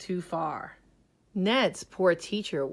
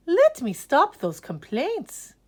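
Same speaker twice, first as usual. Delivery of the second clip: higher, louder, kindly take-charge; brisk art teacher; 8 semitones higher, 6 dB louder than usual. Acted. Art teacher offers to stop the complaints. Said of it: "she said".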